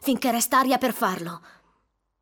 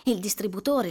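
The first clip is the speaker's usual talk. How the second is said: usual speaking voice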